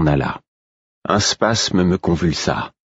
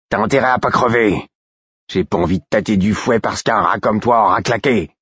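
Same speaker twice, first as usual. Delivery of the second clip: rough, higher-pitched angry guard; growled threat; 6 semitones higher than usual. A narrator's reading. Guard warning the prisoner not to die, growling. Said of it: "gronda l'homme".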